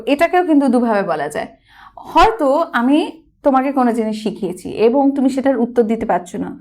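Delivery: usual speaking voice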